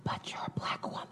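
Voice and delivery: hushed whisper, like bad news. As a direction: whisper it like a diagnosis. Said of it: "they were whispering it to me".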